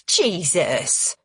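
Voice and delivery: sudden blurt from across the room; blurted exclamation. A narrator's reading. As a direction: let it burst out unplanned. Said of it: "Cheryl blurts out".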